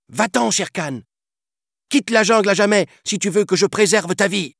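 put-on high, fast, ordering child voice; commanding boy. Mowgli chasing off Shere Khan with the flame, high fast command. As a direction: drive him away with fierce command. Mowgli drives off tiger with fire.

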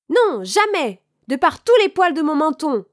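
high, loud piglet refusal; defiant little pig. A narrator's reading. Cochon refuse au loup. (petit cochon qui refuse, voix aiguë et forte)

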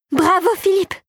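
high, delighted cheer; enthusiastic. A narrator's reading. Clara sees Philippe succeed, cheering enthusiastically. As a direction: cheer it out, pitched well up. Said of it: "s'enthousiasma Clara".

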